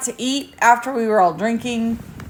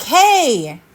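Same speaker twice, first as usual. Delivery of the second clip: mock bully; 10 semitones higher than usual. Mocking bully voice, teasing.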